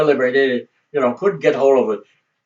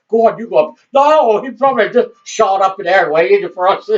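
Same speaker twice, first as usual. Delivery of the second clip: mock nervous bickering; 5 semitones higher than usual. Police daring each other, laughing.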